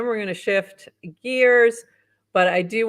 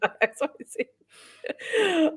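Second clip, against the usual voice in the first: talking through laughter; laughing; 5 semitones higher than usual. Real, not acted. Host teased about a co-host googling bounties, laughing. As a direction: say it while cracking up.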